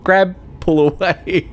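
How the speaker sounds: high, choked little order to yourself; tearful self-instruction. Retold or imitated. Coaching himself away from crying, high and choked. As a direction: steer away from the tears. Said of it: "And I'm like".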